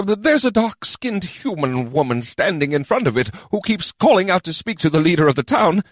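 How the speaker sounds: high, squeaky, stammering goblin; nervous goblin adviser. A narrator's reading. Goblin lookout reporting the dragon, nervous and squeaky. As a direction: squeak out a jittery report in fits and starts.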